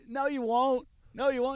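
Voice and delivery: high, teasing retort; amused taunt. Retold or imitated. Laughing storyteller answering a screaming driver's threat, teasing. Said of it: "I just start laughing".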